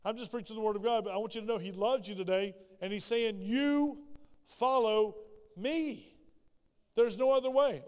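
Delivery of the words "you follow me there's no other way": tender call. Acted. Jesus calling lovingly.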